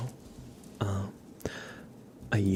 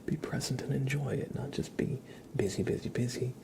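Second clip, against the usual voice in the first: ASMR whisper; 4 semitones higher than usual. Acted. Streamer whispering reflections to viewers, ASMR whisper. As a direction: breathe the words, no voice.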